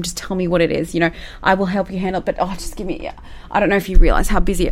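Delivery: mock-flustered, rushing rant; stressed, frantic manager. Manager showing staff how stressed they are, frantic and hammed-up. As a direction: put on a harried boss, words tumbling out. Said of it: "love to show how stressed they are".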